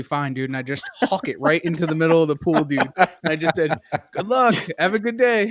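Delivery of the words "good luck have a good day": high mock-cheery farewell; sarcastic send-off. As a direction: toss it out high and falsely friendly. Sarcastic farewell after throwing boulder.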